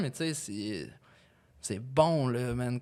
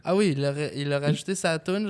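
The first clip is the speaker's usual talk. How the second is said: normal talking voice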